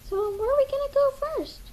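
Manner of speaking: squeaky cartoon sidekick; high bird voice. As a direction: chirp it like an excited cartoon bird. Bird voice eager to start.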